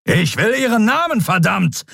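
high, angry outburst; thundering demand. A narrator's reading. Admiral demanding a prisoner's name, thundering. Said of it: "donnerte Thelios".

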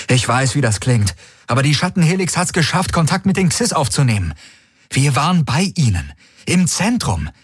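high, pressing warning to the fleet; urgent envoy. A narrator's reading. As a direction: plead urgently over the comm, pitched high. Envoy argues for ceasefire. (eindringliche, hohe Warnung an die Flotte)

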